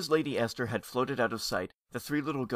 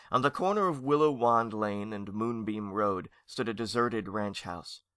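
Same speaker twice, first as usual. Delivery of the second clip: ordinary delivery, nothing marked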